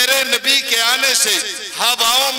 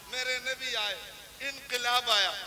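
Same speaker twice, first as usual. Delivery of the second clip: sudden hush; 15 dB quieter than usual. Acted. Sudden drop after shouting.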